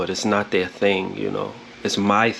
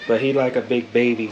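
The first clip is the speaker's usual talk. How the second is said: ordinary talk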